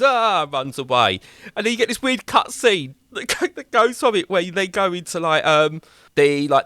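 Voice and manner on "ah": mock yelp. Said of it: "and he goes".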